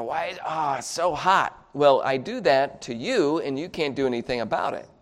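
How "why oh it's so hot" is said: put-on groaning gripe; complaining. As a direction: act out a grumble about the heat.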